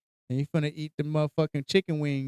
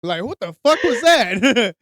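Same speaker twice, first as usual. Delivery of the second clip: ordinary talk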